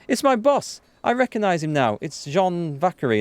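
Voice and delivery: high, sudden burst of recognition; excited, pitch leaping up then easing; excited realisation. Real, not acted. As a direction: blurt it out high as it clicks.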